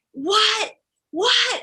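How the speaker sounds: high-pitched outraged mock yell; upset driver. Upset driver to his sick passenger, high mock outrage. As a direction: imitate an incredulous, upset driver. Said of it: "the driver just was very upset".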